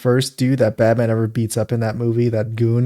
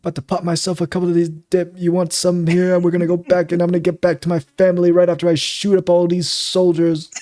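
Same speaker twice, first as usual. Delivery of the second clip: put-on soldier ramble, held high throughout; 7 semitones higher, 3 dB louder than usual. Host showing how the actor sounds, fake soldier. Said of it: "That's what he sounds like".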